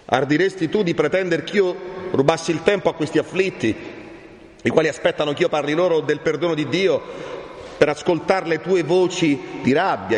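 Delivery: high, impassioned reproach; indignant rebuke. Acted. Friar refuses to steal time from the afflicted, indignant. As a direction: drive through the long question with indignation.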